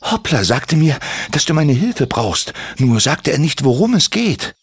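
leicht höher gesprochener Kobold, freundlich; junger Kobold. Acted. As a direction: ein kleiner, eifriger Kobold fragt nach. Kobold Timmy spricht. (Kobold Timmy fragt Andi, leicht höhere Stimme)

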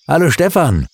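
a high call across the yard. A narrator's reading. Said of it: "rief ihm sein Freund Sebastian zu".

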